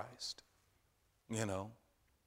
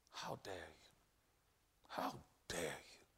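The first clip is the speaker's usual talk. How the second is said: low, much softer whispered taunt; whispering devil; 7 semitones lower, 11 dB quieter than usual. Acted. Preacher voicing the devil whispering, low and hushed. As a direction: hiss it like the devil in your ear. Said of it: "the devil is saying".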